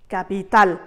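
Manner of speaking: capital with the last syllable tal held longer; Spanish capital, accent on the final syllable; stress on final tal. Shown as a demonstration. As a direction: lean into tal at the end of capital. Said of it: "c'est la dernière syllabe qui portera l'accent".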